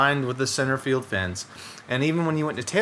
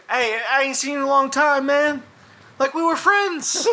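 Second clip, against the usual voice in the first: higher, mock-enthusiastic old-pal voice; imitating an overfriendly greeting; 12 semitones higher, 3 dB louder than usual. Retelling a player's eager greeting, in mock high excitement. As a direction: ham up his big friendly hello. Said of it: "this is a quote".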